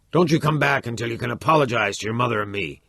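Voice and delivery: fast, loud, stern command; angry order. A narrator's reading. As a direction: throw him out, furious. Father ordering son out.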